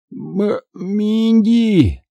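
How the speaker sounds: slow, high strangled call; groaning moan. Acted. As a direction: moan the name out, dragging it. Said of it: "со стоном промычал я". (протяжный стон с мычанием)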